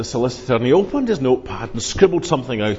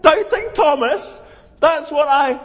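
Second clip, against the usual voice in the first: dismayed realisation; 11 semitones higher, 3 dB louder than usual. Acted. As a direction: realise the insult, voice jumping up. Thomas reads the paper.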